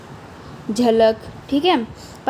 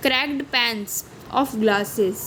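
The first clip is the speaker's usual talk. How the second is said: slow poem reading; 4 dB quieter, much slower than usual. Acted. Rereads poem line.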